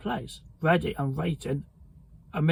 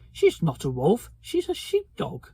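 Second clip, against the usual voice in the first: put-on high, hurt young voice; sounding wounded, voice jumping up; 4 semitones higher than usual. A narrator's reading. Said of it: "in a rather hurt voice".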